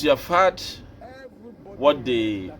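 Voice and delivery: normal talking voice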